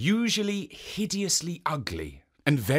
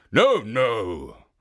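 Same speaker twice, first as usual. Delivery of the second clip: suspicious ogre; 2 semitones lower, 6 dB louder than usual. Acted. Oni smelling a woman nearby, loud and suspicious. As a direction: call out suspiciously like a hungry ogre.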